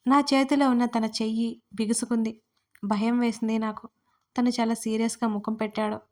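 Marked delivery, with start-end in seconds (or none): none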